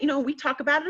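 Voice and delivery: ordinary talk, nothing marked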